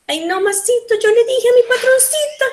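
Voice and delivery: high, singsong Mexican accent; exaggerated Mexican intonation demo. Shown as a demonstration. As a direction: put on a quick, high-pitched Mexican lilt. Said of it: "in Mexico, they say".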